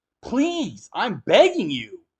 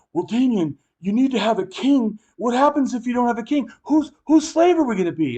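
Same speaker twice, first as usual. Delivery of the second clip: rising, higher mock worry; worried statist; 6 semitones higher than usual. Mocking people who want a king, rising worried voice. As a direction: play the nervous king-lover, climbing in pitch. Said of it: "like you're going".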